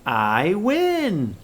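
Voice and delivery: a happy little win; excited. Shown as a demonstration. Teacher trying the sentence aloud, excited. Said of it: "pretty excited sentence".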